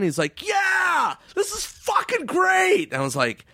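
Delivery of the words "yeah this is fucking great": very high, drawn-out mock yell; retold ecstatic shout. Friend high on the dance floor, ecstatic shouting.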